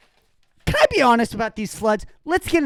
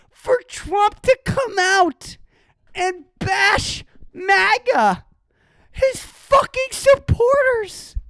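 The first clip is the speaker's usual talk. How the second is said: high, slow exasperated rant; high, drawn-out outraged rant; incredulous ranting; 11 semitones higher, slower than usual. Real, not acted. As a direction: stretch it out in outraged disbelief. Ranting about Trump.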